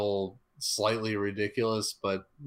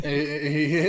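laughing; 6 semitones higher, 6 dB louder than usual. Real, not acted. Laughing while echoing Vader.

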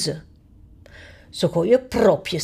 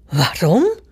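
surprised. Acted. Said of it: "vraagt de lakij verbaasd".

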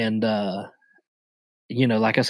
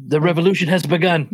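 laughing; 9 semitones higher, faster than usual. Retold or imitated. Laughing quote of Korg.

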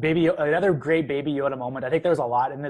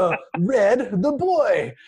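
high, squeaky alien junk-dealer imitation; Watto; 7 semitones higher, 4 dB louder, slower than usual. Podcaster jokingly imitating a Star Wars junk dealer, voice pitched up. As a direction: pitch way up for a quick alien-trader bit. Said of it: "Is that Watto?".

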